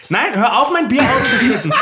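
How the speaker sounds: laughing shout. Real, not acted. Beer being spilled.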